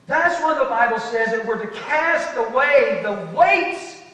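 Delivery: loud, high-pitched pulpit delivery; preaching. Real, not acted. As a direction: preach it hard to the congregation. Preaches at full force.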